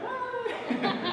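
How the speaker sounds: playing the confused gentleman in the crowd; mock baffled what. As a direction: squeak out a disbelieving what. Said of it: "That gentleman right there is like".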